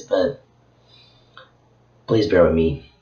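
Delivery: ordinary delivery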